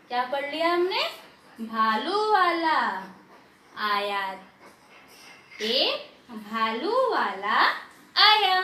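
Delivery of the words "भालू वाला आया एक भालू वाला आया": slow, stretched vowels with gaps between words; slow reading. Shown as a demonstration. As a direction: model a slow read-aloud for pupils. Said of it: "धीरे धीरे पढ़ो". (रुक-रुककर, धीरे-धीरे पढ़ना)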